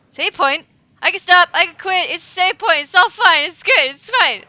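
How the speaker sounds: loud, high excited relief; relieved shouting. Real, not acted. Player finding a save point, loud excited relief.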